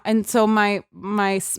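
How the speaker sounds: ordinary talk, nothing marked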